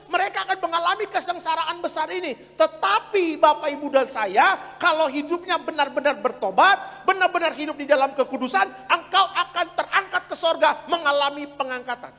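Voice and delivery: loud, high, impassioned preacher; preaching at full force. Real, not acted. Pastor promising the congregation rapture, preached at full force. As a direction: drive each phrase home to the crowd.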